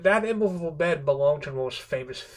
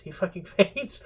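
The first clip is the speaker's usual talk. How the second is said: higher, laughing through the words; amused disbelief; 6 semitones higher than usual. Real, not acted. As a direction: break into a laugh on the swear word. Laughs at the husband fainting.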